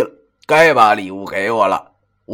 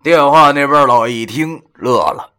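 usual speaking voice

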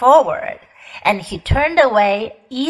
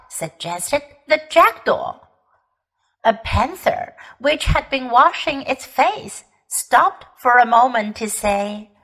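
usual speaking voice

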